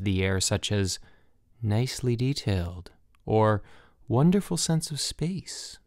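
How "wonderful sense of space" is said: ordinary delivery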